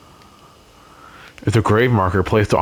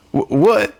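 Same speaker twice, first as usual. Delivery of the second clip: startled; 10 semitones higher than usual. Real, not acted. Startled by noise.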